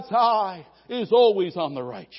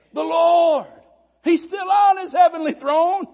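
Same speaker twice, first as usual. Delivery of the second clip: emphatic proclamation; 6 semitones higher, 5 dB louder than usual. Retold or imitated. Preacher voicing David's assurance.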